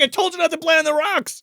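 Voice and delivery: high, loud mock scolding; exasperated scolding mother. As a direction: scold the child as a worked-up mom. Mom scolding back.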